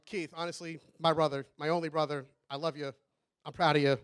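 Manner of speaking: soft, low and heartfelt; sincere affection. Real, not acted. Best man telling his only brother he loves him, softly sincere. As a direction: say it quietly, meaning every word.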